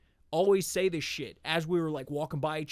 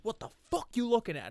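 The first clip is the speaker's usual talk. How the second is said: higher-pitched confrontational imitation; mock aggressive challenge; 7 semitones higher than usual. Pacing man to a neighbour with groceries, mock aggressive challenge. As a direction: act out a hothead picking a fight.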